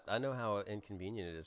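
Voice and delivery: normal talking voice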